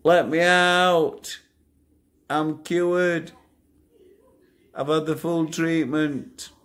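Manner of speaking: high, slow, pleading call from a cell; mock prisoner begging to be let out, stretched and high. Prisoner calling to the guard from his cell, high and drawn out. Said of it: "That's what I used to say to Scrooge".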